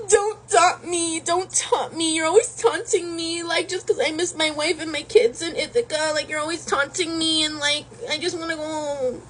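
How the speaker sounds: high, whiny hammed-up sobbing; mock crying. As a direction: blubber it like a homesick wreck. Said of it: "finds Odysseus across the island crying".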